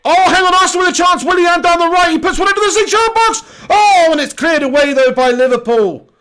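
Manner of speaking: shouted, very high football commentary; frantic play-by-play. Real, not acted. Commentator calling an Arsenal chance cleared by Liverpool, frantic play-by-play. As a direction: yell the attack as it happens.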